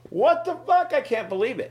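very high, loud cry of disbelief; imitating a stunned fan; shocked fan. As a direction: yelp it like you just saw a ghost.

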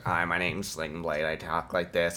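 low, soft, weird character drone; Sling Blade. Retold or imitated. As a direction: talk like an oddball, dropped low and quiet. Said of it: "Billy Bob Thornton, he's like".

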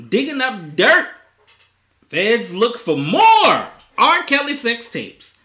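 headline read. Acted. Reads headline.

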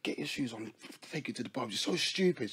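hushed, unvoiced retelling; whispering. Real, not acted. Telling friends the story, suddenly whispers. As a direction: drop to a whisper. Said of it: "whispered retelling".

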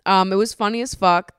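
ordinary delivery

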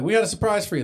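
high, loud teasing excitement; excited sing-song. Acted. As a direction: tease a big surprise. Excited filly voice.

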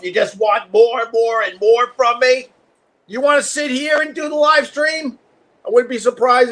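high, teasing scold; mock scolding the dog. Real, not acted. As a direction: playfully tell off the pet.

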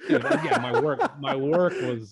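high, loud words through laughter; laughing in disbelief. Real, not acted. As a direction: crack up at your own bad work. Laughing at own early work.

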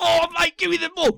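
high, loud mock yell of a drunk player; drunk footy bloke. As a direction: ham up a rowdy pub player begging for the ball. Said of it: "And you go".

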